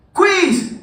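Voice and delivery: very high squeaky teacher call; mock teacher. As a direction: yelp it like a gleeful teacher. Teacher springs surprise quiz.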